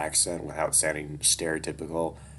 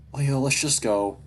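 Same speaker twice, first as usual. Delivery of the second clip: voiced hiss, pitched high and louder; urgent hiss; 8 semitones higher, 6 dB louder than usual. A narrator's reading. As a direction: urge him away through the side of the mouth. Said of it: "I hiss out the side of my mouth".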